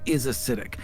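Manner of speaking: normal talking voice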